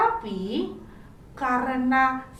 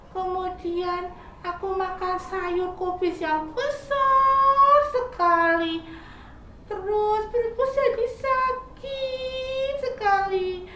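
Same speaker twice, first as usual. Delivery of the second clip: sick caterpillar; 7 semitones higher, slower than usual. Acted. Caterpillar telling squirrel his stomach hurts, high whiny voice. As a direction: answer like a sorry sick child.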